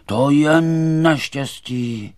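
loud, drawn-out wail of alarm; high, slow exclamation; crying out. A narrator's reading. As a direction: cry out, stretching the words in dismay. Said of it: "někdo vykřikl".

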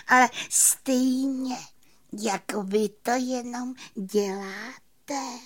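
high, slow, wondering fairy; sighing little fairy. A narrator's reading. Fairy wondering at the magician's trick, high slow sighing. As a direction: stretch the last word in wonder. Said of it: "vzdychla malá víla plavůňka".

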